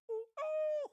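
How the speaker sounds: high-pitched ooh; high hoot. Acted.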